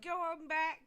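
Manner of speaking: sudden high, loud cry of delight; excited shout. Real, not acted. Host reading out a viewer's news, excited shout. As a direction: burst out with real excitement. Reacting to Becky being in labour.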